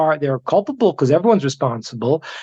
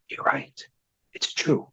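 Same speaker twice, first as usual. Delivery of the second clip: whisper; 4 semitones lower, 9 dB quieter than usual. Retold or imitated. Bishop's stunned confession.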